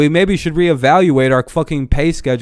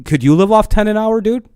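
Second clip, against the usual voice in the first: higher, pointed challenge to the boss; 8 semitones higher than usual. Pretend. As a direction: put it right in his face. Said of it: "look at him and go".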